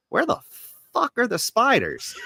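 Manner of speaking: bored viewer losing patience, pitch shooting up; exasperated outburst. Retold or imitated. Retelling waiting an hour for spiders, exasperated high outburst. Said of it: "I was just like".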